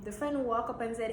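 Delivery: ordinary talk, nothing marked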